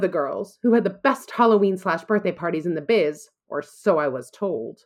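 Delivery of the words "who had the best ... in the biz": ordinary talk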